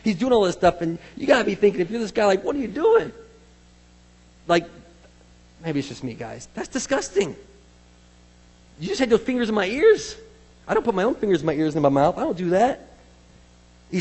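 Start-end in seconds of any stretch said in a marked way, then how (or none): 2.4-3.1 high-pitched, incredulous question; bewildered protest. Acted. Man being healed questions Jesus, bewildered and protesting. 6.5-11.5 high-pitched, grossed-out objection; mock disgust. Preacher voices the man with fingers in his ears, disgusted.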